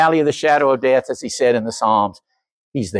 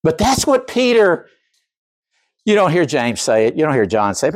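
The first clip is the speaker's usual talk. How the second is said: usual speaking voice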